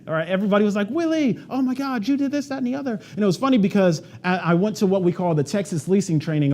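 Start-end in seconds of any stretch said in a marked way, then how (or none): 0.9-3.0 much higher, gushing coworker voice; mock gushing praise. Coworkers gush over Willie's success, high mock excitement.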